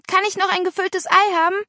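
pleading request. A narrator's reading. Hungry girl asking at the supper table, pleading. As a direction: ask eagerly for seconds. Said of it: "bat Paula".

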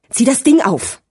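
commanding. A narrator's reading. Gigi orders Sirio to get ready, commanding. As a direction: give a curt order to an accomplice. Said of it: "befahl Gigi".

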